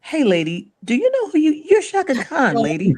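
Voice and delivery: excited retort. Retold or imitated. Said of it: "I said".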